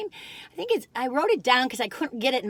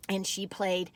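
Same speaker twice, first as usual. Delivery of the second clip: ordinary talk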